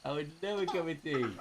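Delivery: high, soft cooing voice; baby talk. Acted. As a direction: coo it to a toddler.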